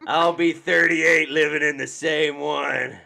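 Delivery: a lightly voiced resigned kid, laughing through it; slow and laughing, a kid already beaten by life; hint of a glum child with a giggle; weary kid. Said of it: "he's like".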